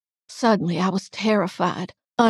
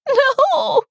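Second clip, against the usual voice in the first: very high, loud wail; shrieked, desperate refusal; terrified begging; 9 dB louder than usual. A narrator's reading. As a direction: wail in panic. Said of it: "I said it again".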